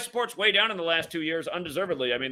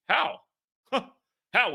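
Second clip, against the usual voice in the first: incredulous; 6 semitones higher than usual. Real, not acted. Mocking troll's claim.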